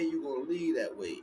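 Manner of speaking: usual speaking voice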